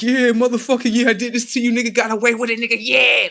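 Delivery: high, loud mocking yell; gloating taunt. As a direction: crow over the victim at full voice. Acted gloating quote, watchers' taunt.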